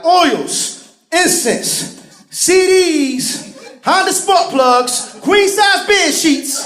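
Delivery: high, loud hawker's call; street vendor announcing. Retold or imitated. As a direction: call out wares like a street seller. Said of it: "always has to announce it".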